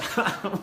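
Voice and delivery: brief high laugh; short laugh. Real, not acted. Host joking with Katie about lunch, short high laugh. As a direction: laugh lightly at your own joke.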